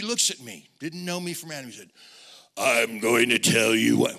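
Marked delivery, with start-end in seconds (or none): 2.5-4.2 slow, loud tough-guy line; gruff quote. Retold or imitated. Preacher voicing Greg's threat, slow and loud. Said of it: "Well, he didn't talk like that".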